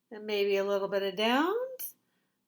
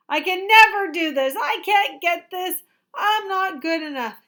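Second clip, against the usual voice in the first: loud, high frustrated outburst; frustrated kid; 9 semitones higher, 12 dB louder than usual. Acted. Teacher voicing a discouraged student's loud complaints. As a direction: yell it like a stuck, upset child. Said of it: "words you might be saying when you're down".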